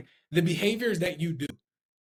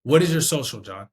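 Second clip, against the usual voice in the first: loud, clearly worded question; sure, projected request; confident ask; 3 semitones lower, 5 dB louder than usual. Shown as a demonstration. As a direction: ask it firmly, every word in place. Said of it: "You can hear in my voice that I sound confident".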